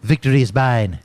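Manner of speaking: Family Guy Stewie voice; a short, showy Stewie impression; Stewie accent. Shown as a demonstration. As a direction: put on a cartoon Stewie accent. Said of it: "I got a great Stewie accent".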